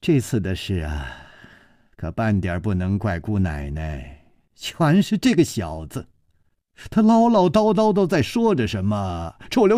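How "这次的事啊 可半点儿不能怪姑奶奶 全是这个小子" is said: placating, mostly low-pitched; ingratiating, conciliatory explanation; apologetic smile. A narrator's reading. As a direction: smile along and smooth it over. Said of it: "陪着笑说".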